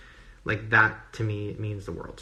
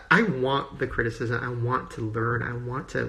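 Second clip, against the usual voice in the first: ordinary delivery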